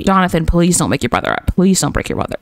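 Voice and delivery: usual speaking voice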